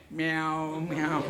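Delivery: playful cat imitation, a husband gone meek before his wife; cat meow. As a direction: mew it meekly like a tame house cat. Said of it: "Be like a, uh, cat".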